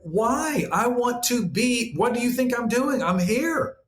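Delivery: an excited soul cheering you on; eager soul. Retold or imitated. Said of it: "And it was like".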